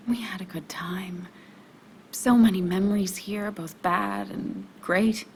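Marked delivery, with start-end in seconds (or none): 0.1-1.3 hushed, wistful whisper; soft whisper. Acted. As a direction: breathe it out, remembering. Tender memory with ex.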